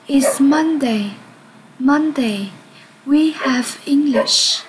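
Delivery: slow, clear English model sentences; slow model reading. Shown as a demonstration. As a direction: read slowly for the class to repeat. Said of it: "nghe và lặp lại theo cô". (đọc mẫu chậm rãi)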